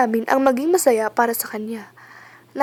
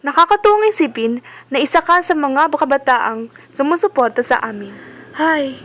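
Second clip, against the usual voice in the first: normal talking voice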